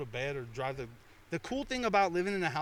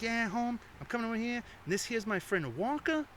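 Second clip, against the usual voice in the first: a lightly voiced Boston Irish buddy, pitched higher; imitating Mister Wonderful; 8 semitones higher than usual. Buddy introducing the new guy, mild Boston impression. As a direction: bounce it up high like a little Boston guy. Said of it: "this little short Boston Irish cat".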